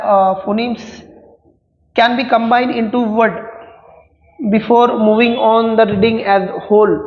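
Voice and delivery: slow, deliberate dictation reading; reading exam options. Acted. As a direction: read each word out slowly for students to follow. Reads question options aloud, slowly.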